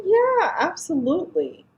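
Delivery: high, loud, overeager yes; eager agreement. Retold or imitated. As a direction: jump at the request brightly. Eager retold reply to nurse.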